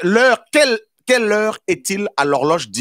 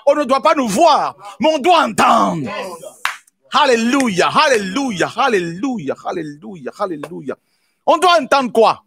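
full-force preaching; 6 semitones higher than usual. Acted. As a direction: preach it out, rousing the church.

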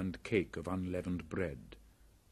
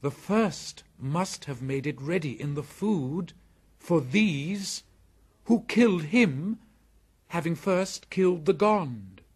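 put-on excited boy voice; 10 semitones higher, 9 dB louder than usual. Mowgli works out the killings.

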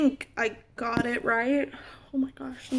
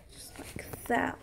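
slow whisper; 14 dB quieter than usual. Real, not acted. Quiet work on the Furby.

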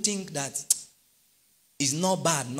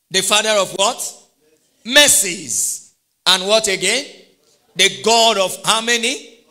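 call to congregation; 5 semitones higher, 11 dB louder than usual. Acted. Quizzing the congregation loudly.

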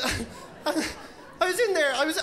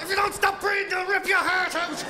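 mock rage; 7 semitones higher than usual. Comic to crowd, mock furious partner at dinner. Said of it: "you're going".

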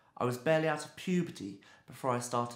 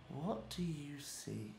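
slow, near-whispered question; hushed question; 10 dB quieter, slower than usual. Acted. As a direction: ask it very softly, drawing out the words. Urging a close look into eye.

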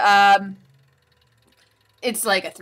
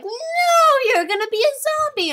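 high, loud cry of alarm at a bite; 6 dB louder, faster than usual. Retold or imitated. Viewer yelling at the screen in high alarm. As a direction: shriek it like a horrified moviegoer. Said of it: "and you're like".

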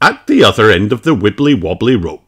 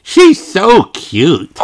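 high, slow, louder cooing; adoring; 12 semitones higher, 7 dB louder, much slower than usual. Acted. Peppa gushing about the koala, slow coo. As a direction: coo over the cute koala.

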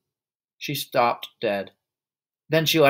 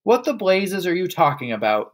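raised, higher indignant yell; 6 semitones higher than usual. A narrator's reading. Father baffled by his wife's accusation, yelled. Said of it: "the father yelled".

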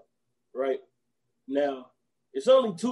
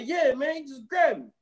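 pitched way up, eager yeah man; high insisting; 8 semitones higher than usual. Retold or imitated. Chris Tucker insisting to Faizon, high and eager. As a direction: oversell it like a con man.

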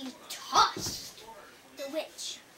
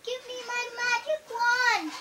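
high, loud, drawn plea; acted urgent begging, pitched up; begging witch; 8 semitones higher, 5 dB louder than usual. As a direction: beg as a sinking storybook witch. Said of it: "she begged".

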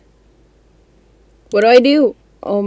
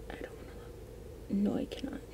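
hiding from the monster in the game, breathless whisper; scared whisper; 22 dB quieter, faster than usual. Real, not acted. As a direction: whisper it, terrified, breath only.

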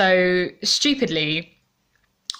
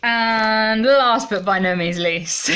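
laughing; 4 semitones higher, 4 dB louder, slower than usual. Real, not acted. Laughing build-up to last gift.

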